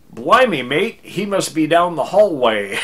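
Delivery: loud, high, deliberately bad non-American accent; hammed-up "blimey, mate" accent, badly faked; terrible fake accent. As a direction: pass yourself off as a foreigner with an awful accent. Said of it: "trying to do the worst accent ever".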